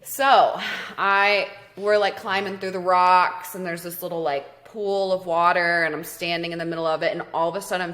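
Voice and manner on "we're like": usual speaking voice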